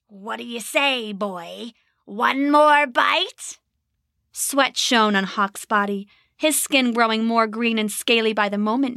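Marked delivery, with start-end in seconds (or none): 0.1-3.6 slow, wheedling goblin whisper-tease; sly tempting goblin. A narrator's reading. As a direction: coax him with one more bite.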